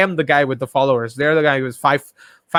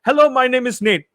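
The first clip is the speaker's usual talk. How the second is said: put-on call-centre voice, pitched way up; scam caller; 8 semitones higher than usual. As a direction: imitate a scam caller's opening line. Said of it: "they're fucking always saying that".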